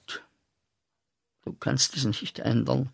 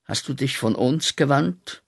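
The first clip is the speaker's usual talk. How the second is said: ordinary talk, nothing marked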